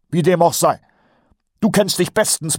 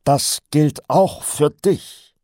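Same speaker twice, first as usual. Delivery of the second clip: slow, higher, pointed retort; grim relish; 9 semitones higher, 5 dB louder, much slower than usual. A narrator's reading. Sergeant turns the risk back on corporal, grimly pointed. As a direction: spell it out slowly with a grim grin. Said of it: "betont grimmig grinste".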